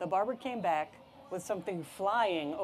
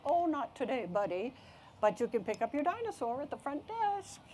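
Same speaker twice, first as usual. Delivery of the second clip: high, singsong nurse voice; cheerful nurse; 7 semitones higher than usual. Acted. Smiling nurse to an eager boy, cheerful singsong. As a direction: lilt it high, kindly putting off a child. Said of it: "The nurse smiled".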